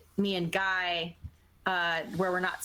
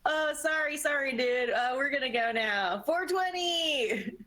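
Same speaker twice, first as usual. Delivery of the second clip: higher, sing-out tipsy apology, last words stretched; badly faked drunk, pitched up; pretend drunk; 6 semitones higher than usual. Trespasser apologizing to the guard on the way out, playing drunk. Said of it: "pretend to be drunk".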